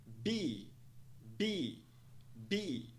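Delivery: "be" with long EE, falling; teacher's isolated model of "be"; one syllable. Shown as a demonstration. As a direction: say "be" as one whole, like "bee". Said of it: "Uma sílaba apenas".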